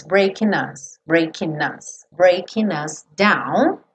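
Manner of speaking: breaking us run together as one word, slowing; "breaking us" linked, drawn out; linked consonant. Shown as a demonstration. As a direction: carry the N of breaking into us. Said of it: "posso ligar o N do breaking no us".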